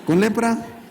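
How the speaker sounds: LEPRA lifted high as a question; playful question. Acted. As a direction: pitch up on "lepra", as if testing a trick. Moisés repite el milagro. (pregunta juguetona, "lepra" aguda)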